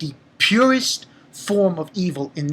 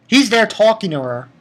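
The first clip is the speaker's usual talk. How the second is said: ordinary talk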